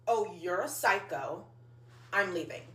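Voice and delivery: low, soft, slow verdict; retold quiet walk-away line; cold dismissal. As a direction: drop the voice and coolly check out. Cold exit after being insulted.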